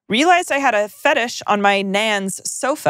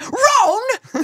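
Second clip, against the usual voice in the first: a very high, shrill-free Cockney call; Cockney Harry; 13 semitones higher than usual. Pretend. Harry calling Ron in mock Cockney. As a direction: yell Ron like a London lad. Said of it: "That would have just been more like".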